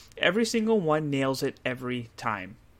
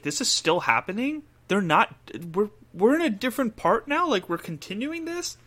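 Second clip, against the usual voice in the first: incredulous; 8 semitones higher, 3 dB louder than usual. Retold or imitated. Disbelief at the long fight.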